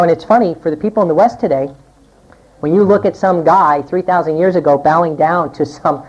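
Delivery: ordinary talk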